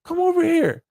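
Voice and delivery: mock player sweet talk; smooth-talking guy. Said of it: "Goes like".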